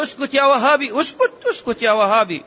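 high, mocking angry snap; angry retort. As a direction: shout him down, high and scornful. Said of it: "تقول له بغضب". (صرخة غاضبة ساخرة بصوت مرتفع)

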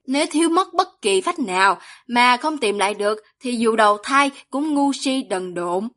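ordinary delivery, nothing marked

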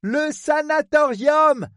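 high, drawn-out shout; shouted repeat. Acted. Woman repeats for the man who misheard, slow shout. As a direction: call it out slowly to be heard.

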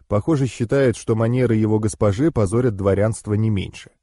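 ordinary delivery, nothing marked

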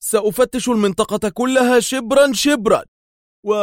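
high, sharp retort; sharply. A narrator's reading. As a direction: snap it out in anger. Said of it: "قال دار في حدة".

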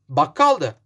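plain reading voice

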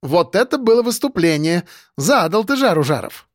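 lightly voiced, gleeful colleague; impressed excitement. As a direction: marvel teasingly at the show. Impressed colleague exclaims afterwards.

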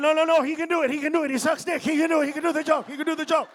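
high, loud excited pleading; hammed-up excited defense; excited defender. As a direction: rush it like an eager fan. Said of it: "you're gonna be like".

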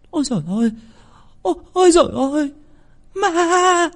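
shrill high terrified shriek, voice leaping up; terrified scream. A narrator's reading. Old servant woman screaming in terror at a ghost. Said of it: "bà hét toáng lên".

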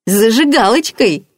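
high, loud, silly delight; dopey sing-song with a grin; foolish grin. A narrator's reading. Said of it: "Петька глупо ухмыльнулся".

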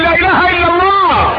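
high, slow shouted declaration; pulpit shout. Acted. Preacher calls the crowd to declare God's oneness, shouting high and slow. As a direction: call it out to the whole mosque.

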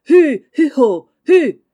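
long, loud, high calls to a horse; carter's shout. Acted. Laurent urging on the cart horse, shouting. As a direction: drive the horse on with drawn-out calls.

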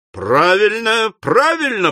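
egging the fight on; proclaiming. A narrator's reading. Bob Sawyer cheering Slurk's challenge, rising. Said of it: "провозгласил Боб Сойер".

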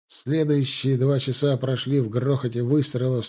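ordinary delivery

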